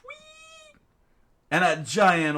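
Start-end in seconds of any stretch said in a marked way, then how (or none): 0.0-0.8 high soft whistle. Acted. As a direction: whistle to call the orca. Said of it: "and whistled out".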